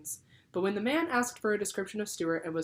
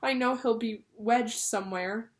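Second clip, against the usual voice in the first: higher, slow lament; tearful mother; 6 semitones higher, 4 dB louder, slower than usual. A narrator's reading. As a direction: plead it near tears, slowly. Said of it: "Missus Little began to cry".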